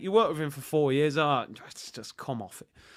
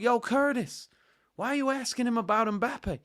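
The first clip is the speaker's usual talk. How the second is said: lightly voiced New York heckle, higher; mock New York accent, higher; bad New York accent; 7 semitones higher than usual. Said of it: "not the greatest of New York accents".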